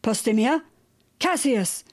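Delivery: understated cry for someone; calling out. A narrator's reading. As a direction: call each name into the distance. Blind man calls out to both.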